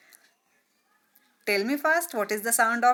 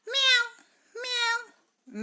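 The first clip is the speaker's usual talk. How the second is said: high squeaky meow; a cat's meow, pitched way up. Shown as a demonstration. Teacher shows the cat's sound to pupils, high meow. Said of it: "What is the sound of cat?".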